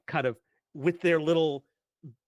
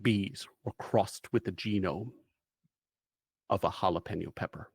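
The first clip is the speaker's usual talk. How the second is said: lightly voiced, solemn mission briefing; measured storyteller laying out the mission; game master's briefing; 4 semitones lower than usual. GM scene briefing narration.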